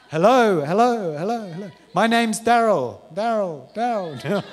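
loud, high, drawn-out echo-chamber hello; echoing mic test. Retold or imitated. Preacher mimicking his voice echoing over a city square. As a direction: play the booming stadium PA echo. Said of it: "I sound like a complete idiot right now".

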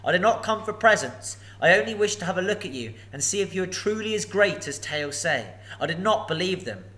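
a hint of nervous courtesy; polite hobbit. Bilbo answers the dragon.

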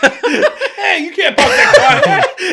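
loud, high mock Boston shout through laughter; laughing Boston accent impression. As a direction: parrot a Boston local, stretched and loud. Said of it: "repeated it in my Boston accent".

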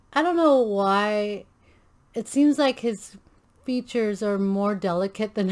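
ordinary talk

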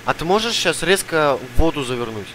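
usual speaking voice

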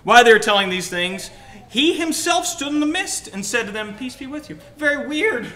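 loud, high scripture reading; animated preaching. Real, not acted. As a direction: preach it out with big pitch swings.

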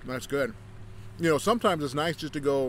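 chewing between words; talking while chewing. Real, not acted. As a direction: mouth partly full of chocolate. Seen 0: Ate chocolate just before; lips pursed, chewing between words.

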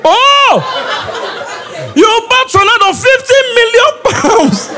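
high, laughing disbelief; cry-laughing at a broke friend; incredulous laughing outburst. Retold or imitated. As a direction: laugh it out in mock shock. Mocking the friend's boast.

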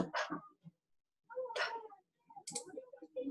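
plain English P on its own, "p-"; "p-" as a bare puff; isolated P sound. Shown as a demonstration. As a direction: sound out "p-" as in pop, no vowel. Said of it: "same sound at the beginning and end of the word".

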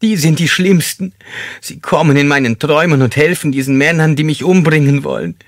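high, loud, agitated character voice; tormented paranoid man. Acted.

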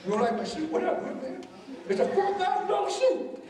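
high-pitched mock tough-guy bluster; in-your-face challenge. Showing kids how not to confront someone, in-your-face. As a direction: act out picking a fight, climbing in pitch. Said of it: "get in somebody's face".

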